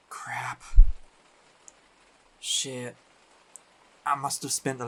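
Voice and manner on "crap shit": muttered curse. Acted. Short of bus fare.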